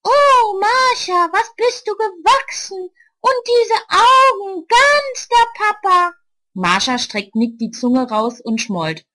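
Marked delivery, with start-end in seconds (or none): 0.0-6.1 very high, loud mock auntie; disguised voice. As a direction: ham up a gushing grown-up. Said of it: "spricht mit verstellter Stimme".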